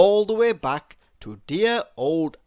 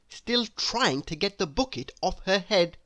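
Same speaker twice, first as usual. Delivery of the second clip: plain reading voice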